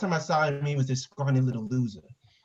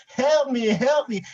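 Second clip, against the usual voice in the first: high, loud imitation of screaming; mock scream; 9 semitones higher, 6 dB louder, slower than usual. Man retelling the woman screaming that woke him, mock scream. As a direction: imitate her cries for help, pitched way up. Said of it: "she just starts screaming".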